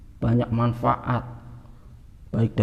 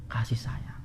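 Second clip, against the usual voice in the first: tender whisper; 10 dB quieter, slower than usual. Acted. Preacher softening on God's love.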